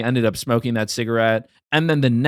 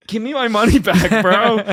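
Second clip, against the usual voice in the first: louder, higher mock protest; indignant demand; 8 semitones higher, 4 dB louder than usual. Retold demand to the cigarette seller, mock indignant. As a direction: demand it back in comic indignation.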